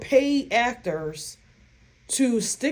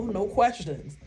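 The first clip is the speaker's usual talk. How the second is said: ordinary talk, nothing marked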